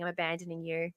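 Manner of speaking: ordinary talk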